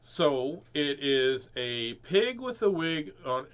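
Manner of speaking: lively, sing-song storybook reading; animated picture-book read-aloud; reading to children. A narrator's reading.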